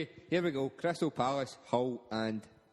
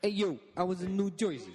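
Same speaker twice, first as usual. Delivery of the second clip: hammed-up Jersey Italian accent, bit higher; New Jersey Italian accent; 4 semitones higher than usual. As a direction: play a Jersey wise guy with an Italian accent. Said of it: "kind of Italian accent".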